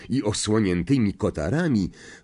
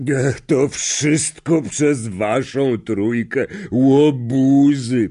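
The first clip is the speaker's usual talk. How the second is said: slow, drawn-out character voice, swelling louder at the end; Hagrid; 4 semitones higher, 5 dB louder, much slower than usual. Acted. Gamekeeper credits the three friends, slow emotional character voice. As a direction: stretch it out, overcome with emotion. Moved gamekeeper answers, in tears.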